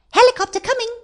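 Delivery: very high, loud radio-style reply; rescuer replying. A narrator's reading. Rescuer answers the boy's call, high announcing voice.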